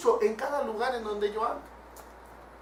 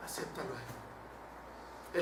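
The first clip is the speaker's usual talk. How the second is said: whisper; 13 semitones lower, 20 dB quieter, much slower than usual. Retold or imitated. Quoted testimony to a friend.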